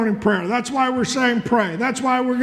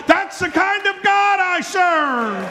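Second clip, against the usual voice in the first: higher, slower, ringing out; fervent preaching shout; 6 semitones higher, slower than usual. Real, not acted. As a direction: proclaim it to the congregation. Preacher's climax.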